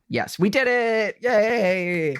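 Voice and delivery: high, bright cheer of triumph; excited cheer. Real, not acted. Co-hosts celebrating remembering where the check went. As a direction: celebrate like you solved it.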